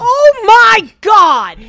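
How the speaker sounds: loud, high, drawn-out exclamation; shocked shout. Real, not acted. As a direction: cry out in scandalized shock. Startled outburst cuts in.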